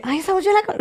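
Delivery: high, soft gasping fan voice; fan recognizing voice. Retold or imitated. Fan in the mall recognizing his voice, high and hushed. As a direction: mimic a fan who just placed his voice. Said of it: "Yo hablo y la gente".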